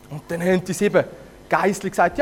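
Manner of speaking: plain reading voice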